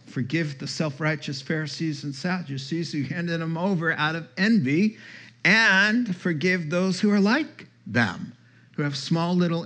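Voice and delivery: ordinary talk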